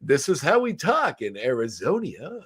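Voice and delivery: slow, swooping put-on voice; hammed-up fake local, stretching the last word; Jackie Daytona. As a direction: draw it out with an exaggerated sing-song lilt. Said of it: "And he's like".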